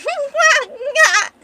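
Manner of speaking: loud, high maniacal cackle; crazy villain laugh. Acted. Crazy assassin laughing in her face, maniacal cackle. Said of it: "and he's just laughing".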